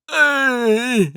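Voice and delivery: very high, drawn-out mock squeal; awkward high-pitched greeting, hammed up. Caught making eye contact, imitating his own squeaky greeting.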